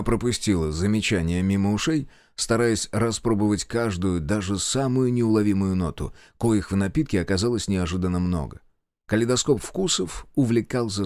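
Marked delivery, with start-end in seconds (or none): none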